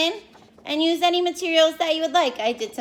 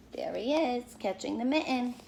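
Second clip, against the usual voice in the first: soft; 10 dB quieter than usual. Real, not acted. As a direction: say it gently and softly. Pointing at picture to children.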